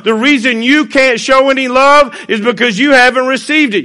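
loud, high, emphatic sermon delivery; impassioned preaching. Real, not acted. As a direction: preach it at full force, leaning hard on key words. Preaches at full force.

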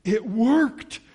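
high, loud exclamation of amazement; excited and emphatic, pitched right up; thrilled realisation. Acted. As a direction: burst out with the discovery. Acting the woman's thought on being healed.

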